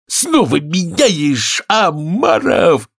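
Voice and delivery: high, stretched-out shriek; wildly excited gryphon; full-voice yell. A narrator's reading. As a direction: holler it long and high. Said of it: "вопил во весь голос Грифон".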